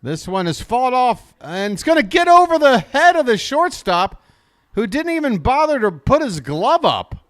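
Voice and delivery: announcer thrilled by a hit; excited call. Real, not acted.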